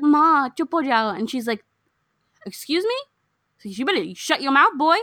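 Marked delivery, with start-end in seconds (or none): 0.0-1.1 high, loud, slow mock plea; Suraj hushing his mother. Retelling Suraj to his mother, high mock protest. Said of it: "Suraj is like". 2.4-5.0 high, indignant mock scolding; badass dadi yelling. Retelling the dadi telling off Suraj, high indignant imitation. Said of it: "she starts yelling at everyone".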